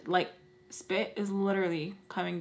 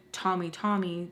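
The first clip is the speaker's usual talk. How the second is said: ordinary delivery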